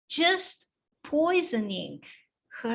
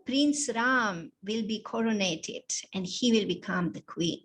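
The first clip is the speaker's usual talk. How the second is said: ordinary delivery, nothing marked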